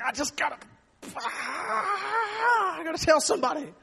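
strained, high-pitched cry of someone about to burst; bursting squirrel impression. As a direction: act out a mouth too full to hold it in. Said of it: "the squirrel has all these".

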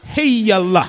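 mock Southern tent-meeting shout of Heal; Southern faith-healer accent. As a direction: belt Heal like a Mississippi revival healer. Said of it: "That's how they say it in the South".

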